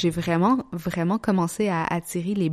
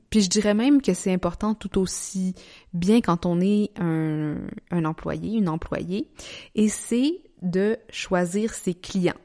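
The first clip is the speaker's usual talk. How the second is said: ordinary talk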